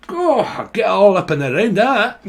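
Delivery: hammed-up Scottish voice cheering someone on; Scottish accent. Imitating a Scottish spirit speaking to viewers, mock Scottish accent. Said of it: "So you got a Scottish person here".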